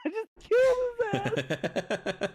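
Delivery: high, loud laughing yell; laughing shout. Real, not acted. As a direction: burst out laughing while saying it. Laughing about killing a character.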